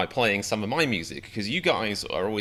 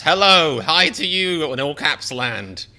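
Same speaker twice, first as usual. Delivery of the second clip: loud, high shouted greeting, slower; all caps shout; 7 semitones higher, 9 dB louder, slower than usual. Pretend. Streamer shouting back at an all-caps chat greeting, loud. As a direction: shout it like a message typed in capitals.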